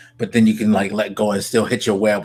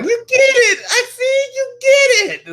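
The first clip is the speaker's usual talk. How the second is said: very high, loud squeal of delight; excited impression of Electro; 6 dB louder than usual. Fan imitating the villain's joy at a shared joke, wildly excited. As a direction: mimic a villain thrilled someone got the joke. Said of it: "the excitement that came in his voice".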